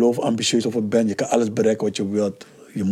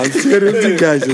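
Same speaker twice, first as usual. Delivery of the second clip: laughing; 6 semitones higher, 5 dB louder than usual. Real, not acted. Laughing protest after being teased.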